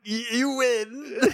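halting, high, half-laughing surrender; hammed-up grudging defeat; mock reluctant concession. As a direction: stammer out a pained concession, slowly. Acts out beaten villain conceding.

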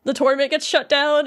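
ordinary talk

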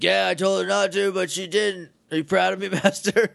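eager minion. Retold or imitated. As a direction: imitate a lackey begging for praise. Minion seeks the master's approval.

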